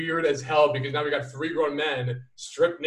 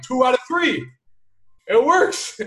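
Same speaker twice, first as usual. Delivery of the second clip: high, loud exclamation of victory; triumphant punchline; 9 semitones higher, 7 dB louder, slower than usual. Retold or imitated. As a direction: crow it high and loud, celebrating. Retold triumphant punchline to friend.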